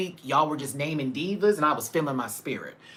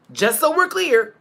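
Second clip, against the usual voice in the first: louder; 12 semitones higher, 8 dB louder than usual. Shown as a demonstration. Repeats louder.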